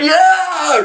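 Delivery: loud high roller coaster whoop; thrill whoop. Retold or imitated. Sliding down like a ride, a high loud whoop. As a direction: cheer like on a ride.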